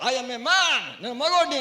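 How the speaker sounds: loud, high declaration of manhood; hammed-up bold proclamation; mock-heroic boast. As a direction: shout it proudly at a dog. Acts boasting to the halted dog.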